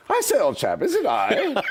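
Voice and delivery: high-pitched, hammed-up British gent; British accent. Judas answering at the Last Supper, British accent. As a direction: deliver the punchline in an exaggerated, climbing British accent. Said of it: "Judas gets up with his British accent".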